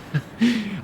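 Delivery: soft, high nervous chuckle; awkward little acted laugh. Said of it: "walk away nervously laughing".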